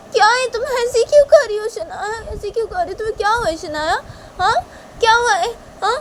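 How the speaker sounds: high-pitched, urgent questioning; high agitated girl character; agitated Tisha. Acted. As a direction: demand answers in a high, worked-up voice. Figure Tisha questions Shanaya.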